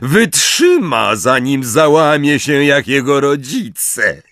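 high, slow gloating voice; gloating Bellatrix. A narrator's reading. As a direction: relish the threat. Bellatrix gloats over Neville. (wysoki, triumfujący głos Bellatrix)

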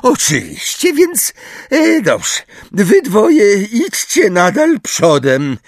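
high, slow Umbridge figure; Umbridge voice, bossy. Acted. Umbridge tells the two to walk in front, high bossy voice. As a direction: order them along in a high, drawn-out voice.

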